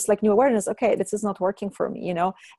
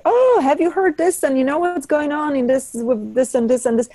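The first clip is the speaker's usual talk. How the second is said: excited friend; 7 semitones higher, 3 dB louder than usual. Retold or imitated. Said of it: "And she's like".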